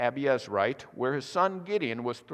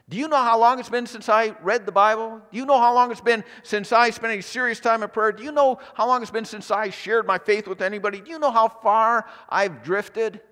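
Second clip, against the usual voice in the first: exasperated complaint; 6 semitones higher, 5 dB louder than usual. Acted. Listener complaining to God.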